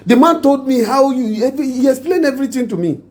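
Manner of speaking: high-pitched, fast exasperated telling-off; exasperated scolding. Acted. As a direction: pitch up high in disbelief as you scold her. Man scolds the caught wife.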